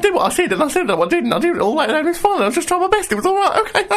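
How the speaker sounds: Catherine Tate character. Retold or imitated. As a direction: squeal it like a defensive TV schoolgirl. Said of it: "just like a Catherine Tate character".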